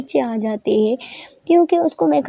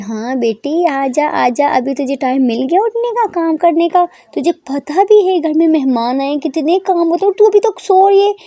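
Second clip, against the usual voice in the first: angry mother; 6 semitones higher, 5 dB louder than usual. A narrator's reading. Mother scolds late daughter.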